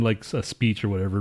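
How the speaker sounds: ordinary talk, nothing marked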